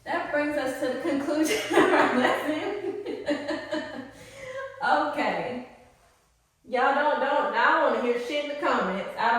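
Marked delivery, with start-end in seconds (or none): 3.0-4.8 a high giggle. Real, not acted. Speaker laughing after ending the lesson, high giggle. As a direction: burst into a little high laugh.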